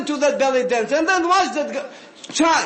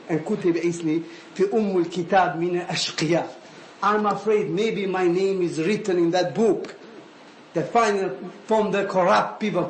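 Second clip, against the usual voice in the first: low, subdued dread; understated fear, confided in a lowered voice; retold fearful confession; 7 semitones lower, faster than usual. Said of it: "I scared".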